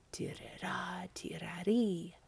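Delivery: soft whispered pipe sound; whispered pipe tune. A narrator's reading. Piper's bagpipes starting under the moon, whispered. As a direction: breathe out the bagpipe notes. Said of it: "the bagpipes resounded".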